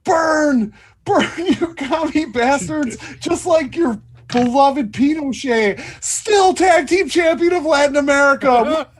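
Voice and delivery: high, full-voiced taunting scream; yelling out the window. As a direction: holler it at enemies from a car window. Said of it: "Luther's gonna yell out the window".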